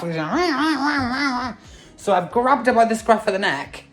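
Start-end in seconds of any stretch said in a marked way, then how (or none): 0.3-1.5 high squealing wah-wah of a tiny screaming animal; shrew scream imitation. Imitating the shrew screaming in the cat's mouth, high squeals. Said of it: "I could hear it screaming".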